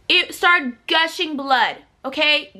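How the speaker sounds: ordinary talk, nothing marked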